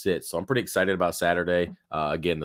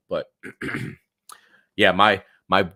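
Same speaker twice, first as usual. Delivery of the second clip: worn-out, scratchy voice; pushing through a shot voice; hoarse voice; 2 semitones higher, 6 dB louder than usual. Real, not acted. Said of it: "my voice is shot".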